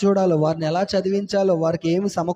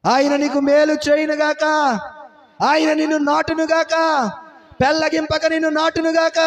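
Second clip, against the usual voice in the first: high, raised benediction; pronouncing a blessing; 8 semitones higher than usual. Acted. Pastor blessing the congregation, high raised voice. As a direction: bless them with force.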